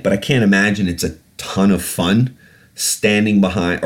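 ordinary delivery

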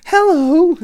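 high, loud character greeting; Mulan. Retold or imitated. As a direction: sing out a big, high-pitched hello. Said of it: "Mulan walks to the table".

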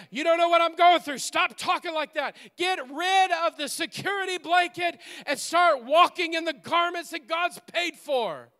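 loud and high, full-throated preaching; shouted climax. Acted. Pastor shouting at the congregation to drop the blanket. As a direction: shout it over them.

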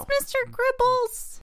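high-pitched wail; tearful child. Acted. Child telling Dad about the guinea pig, tearful. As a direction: wail it like an upset kid. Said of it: "tears aren't gonna make a difference".

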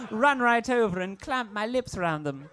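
ordinary delivery

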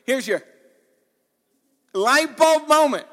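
high, loud light-bulb moment; excited preacher's exclamation; excited realization. Acted. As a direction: proclaim it like a sudden discovery. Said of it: "It's sort of the exciting moment".

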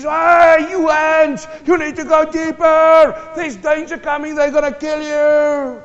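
high, sustained full-force yell; acted all-out warning cry; hard shouted warning. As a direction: shout desperately to warn of danger, stretching the endings. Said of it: "he shouted this time even harder".

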